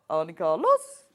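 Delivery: exaggerated Norwegian lilt, "Carlos" swooping up at the end; rising Norwegian accent. Shown as a demonstration. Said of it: "Norwegian has a distinctive rising pitch".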